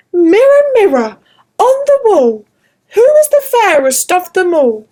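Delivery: a proud queen calling on her mirror; vain queen. Acted. Queen asks magic mirror.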